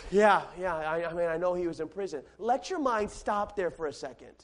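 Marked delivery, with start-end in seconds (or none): 0.1-2.2 sighing, low and soft bored reply; yawning indifference. Acted. Listeners who have heard that before, yawning indifference. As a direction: brush it off through a yawn, low-energy. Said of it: "when I yawn".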